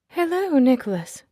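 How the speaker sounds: high, light, tinkling greeting; delicate voice. Acted. Lucy greeting her ex Nicholas, high and delicate. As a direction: sound like a wind chime. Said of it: "Her voice is delicate, like a wind chime".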